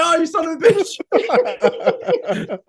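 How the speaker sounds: high, loud, laughing son of a bitch; mock outrage. Caught by his partner in the ring, high laughing outrage. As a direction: yelp it in amused shock. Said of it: "I was like".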